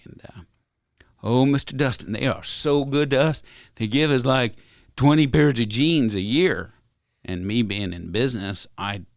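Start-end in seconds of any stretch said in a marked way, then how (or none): 1.2-6.7 put-on higher, louder, excited kid-like reply; lightly voiced eager rodeo kid; eager young cowboy. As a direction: play the thrilled young athlete bragging. Voicing young athlete Tyler's reply.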